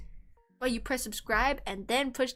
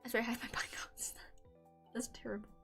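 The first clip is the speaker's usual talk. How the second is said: soft whispered aside; 11 dB quieter, faster than usual. Real, not acted. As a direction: whisper it under breath. Whispered joke to herself.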